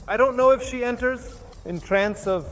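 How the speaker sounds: normal talking voice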